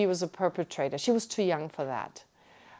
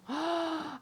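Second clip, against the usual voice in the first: retold gasp; 8 semitones higher, 8 dB quieter than usual. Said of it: "I was so shocked".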